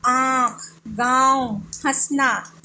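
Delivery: आँख, गाँव, हंसना said singly with drawn nasal vowels; deliberate nasalised example words. Shown as a demonstration. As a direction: send the vowels of आँख, गाँव, हंसना through nose and throat. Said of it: "नाक के पास भी होगा।".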